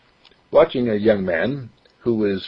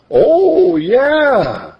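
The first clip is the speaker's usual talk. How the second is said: eager yes; 6 dB louder than usual. Acted. Mark eager.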